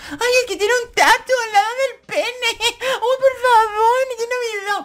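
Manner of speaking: very high squealing falsetto, swooning over a tattoo; shrill mock girly squeal. Vlogger mocking fans swooning over a tattoo, high falsetto squeal. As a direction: squeal it like a giddy fangirl.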